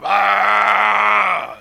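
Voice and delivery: loud sustained engine blare; imitated motorcycle roar. Preacher mimics a show-off's Harley, loud held roar. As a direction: bellow like a Harley revving. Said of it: "Or the Harley motorcycle".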